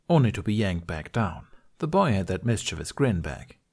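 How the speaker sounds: usual speaking voice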